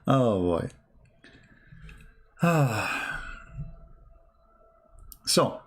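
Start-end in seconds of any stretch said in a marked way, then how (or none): 2.4-3.3 falling, breathed-out sigh; long sigh. Real, not acted. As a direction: let out a sigh after laughing. Winding down after laughing.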